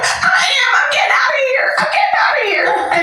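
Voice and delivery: excited shout. Retold or imitated. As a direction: shout it, can't believe it. Tells inmates he is free.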